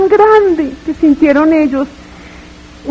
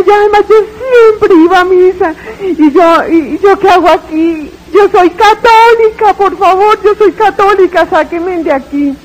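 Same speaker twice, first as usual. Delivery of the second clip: sobbing yell; 4 semitones higher than usual. Retold or imitated. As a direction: beg through tears to be let out. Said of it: "Cuando yo estoy gritando que soy católica".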